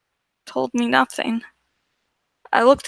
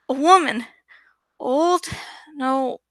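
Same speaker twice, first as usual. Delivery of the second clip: ordinary delivery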